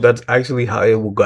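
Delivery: normal talking voice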